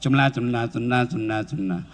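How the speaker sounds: mumbled, clipped syllables, low; mock rushed dhikr. Shown as a demonstration. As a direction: imitate someone gabbling through prayer beads. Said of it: "terlalu cepat cara ngucapnya".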